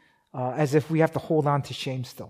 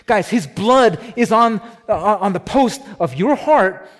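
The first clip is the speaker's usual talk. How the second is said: fervent preaching; 4 semitones higher, 6 dB louder than usual. Real, not acted. Preaching climax to congregation.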